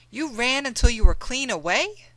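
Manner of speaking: can't believe it; Mammy astonished at John's escape; amazed question. Acted.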